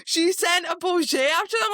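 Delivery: high, loud incredulous question; can't believe what you're hearing; reader's shocked reaction; disbelief. Retold or imitated. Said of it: "And I go".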